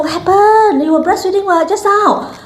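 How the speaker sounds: high-pitched mock questioning; put-on high voice of a surprised nurse; imitated nurse. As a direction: play the nurse coming back puzzled, pitched high. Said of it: "the nurse came back and said".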